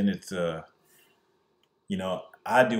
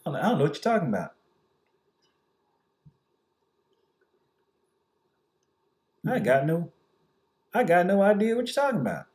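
higher, fast mock innocent denial; feigned ignorance; 6 semitones higher, faster than usual. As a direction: play dumb, rattle it off fast.

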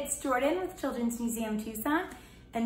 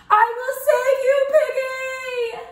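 much higher, loud rescue cry; heroic shout; 13 semitones higher, 8 dB louder than usual. Acted. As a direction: charge in to save her.

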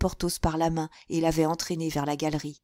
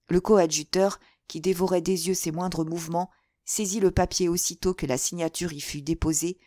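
plain reading voice

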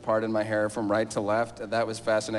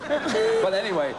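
laughing; 13 semitones higher, slower than usual. Real, not acted. Laughing after audience noise.

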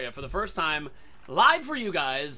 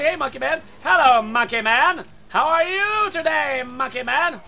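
high, loud, slow taunt; mocking taunt; 8 semitones higher, 9 dB louder, slower than usual. Real, not acted. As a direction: taunt the beaten enemy. Taunting a defeated enemy.

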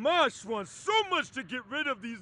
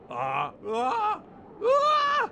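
sneeze build-up; 9 semitones higher, slower than usual. Acted. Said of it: "I feel a huge sneeze coming on".

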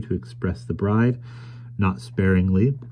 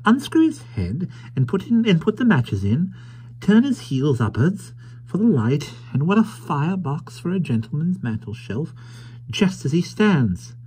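high, swooping wondering voice; enthralled old toymaker; 10 semitones higher than usual. Acted. Caleb imagining a gentleman firebox, high enthralled voice. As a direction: marvel in a high-pitched voice.

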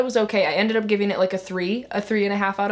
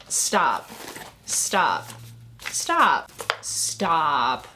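overwhelmed stop; 4 semitones lower, much slower than usual. Real, not acted. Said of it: "I'm gonna cry".

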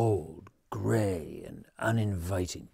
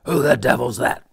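much louder gruff shout from inside; 3 semitones higher, 12 dB louder, faster than usual. Acted. Grumpy old man shouting at the knock on his door. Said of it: "shouted a voice from inside".

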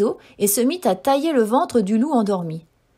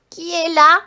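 put-on higher, louder old woman calling out; calling grandmother; 6 semitones higher, 7 dB louder than usual. A narrator's reading. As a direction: call to the door as granny. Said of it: "cria la grand-mère".

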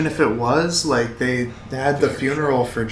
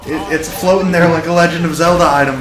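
excited; 5 semitones higher, 7 dB louder than usual. Real, not acted. Excited about floating game item.

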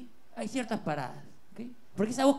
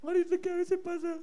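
high, whiny fake crying; mock sobbing apology; 13 semitones higher than usual. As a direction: blubber an apology.

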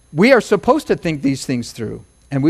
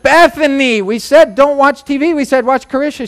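higher, louder exasperated scold; scolding parent; 6 semitones higher, 5 dB louder than usual. Retold or imitated. Parent scolding Bethany for watching TV, higher and louder. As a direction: call out the daughter sternly. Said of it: "And so we said".

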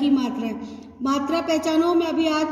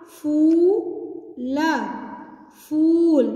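syllable by syllable, then blended; sounding out words; slower than usual. Shown as a demonstration. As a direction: sound out each syllable slowly. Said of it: "अब ध्यान से देखेंगे".

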